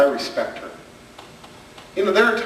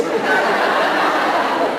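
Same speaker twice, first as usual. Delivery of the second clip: high breathy laugh; 12 semitones higher than usual. Real, not acted. Laugh at kid's answer.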